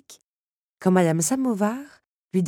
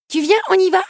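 put-on much higher, louder small child's voice; eager child; 11 dB louder than usual. Zip impatiently calls Belle to come along. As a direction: bounce with impatience to go.

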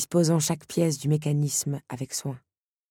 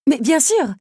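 eager reply; 9 semitones higher, 8 dB louder than usual. A narrator's reading. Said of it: "répliqua Belle".

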